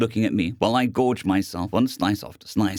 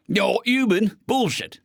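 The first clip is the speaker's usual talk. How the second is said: incredulous robot; 10 semitones higher, slower than usual. Acted. The man mumbled.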